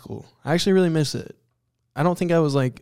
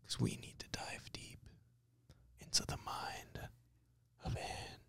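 hushed, drawn-out whisper with long pauses; 23 dB quieter than usual. Pretend. Host teasing a deep dive into a guest's mind, whispered. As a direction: whisper it like a mysterious teaser. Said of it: "You don't have to whisper".

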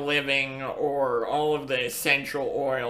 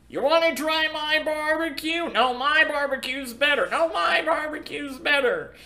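high, bragging barbecue boaster; hammed-up rival pitmasters bragging; boastful local voice; 12 semitones higher than usual. Voicing Kansas City barbecue boasters.

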